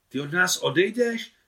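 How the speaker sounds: loud, high, dismayed; upset outcry. Acted. Chadídža dismayed, asking Maria loudly if she leaves. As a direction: plead in alarm, high and loud.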